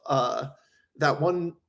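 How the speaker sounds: ordinary delivery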